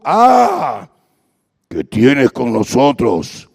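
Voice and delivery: low, slow demonic growl. Acted. Demon crying out at Jesus, low and slow. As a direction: snarl it like a possessed man. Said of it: "espíritu inmundo que dio voces".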